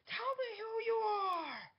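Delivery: very high, loud, drawn-out screech; mad scream. Acted. She pounds on the table at Steven, mad screamed demand. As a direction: scream the demand at full force. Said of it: "she seems mad".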